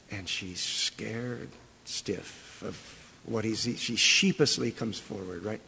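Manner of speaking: slow and hushed, as if the woman were timid; hushed, slowed storytelling. Acted. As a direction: drop to a hushed, slow telling. Describing the frightened woman.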